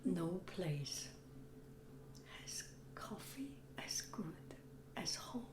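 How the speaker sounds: soft whisper, voice gone; raspy whisper. Real, not acted. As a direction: whisper it, hoarse and hushed. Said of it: "I have no voice".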